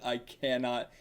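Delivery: ordinary delivery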